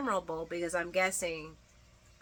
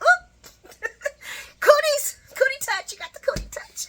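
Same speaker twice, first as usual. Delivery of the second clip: much higher, louder laughing squeal; mock cootie panic; 10 dB louder than usual. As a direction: squeal like a kid dropping a gross hand. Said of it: "drops Christina's hand like".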